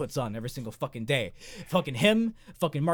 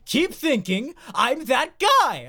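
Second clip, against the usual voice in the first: higher, slow aggrieved whine; whiny bald man; 10 semitones higher, 3 dB louder, slower than usual. Retold or imitated. Bald man whining at being mistaken, high and slow. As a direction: complain like a hurt guy who keeps being mistaken. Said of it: "And he's like".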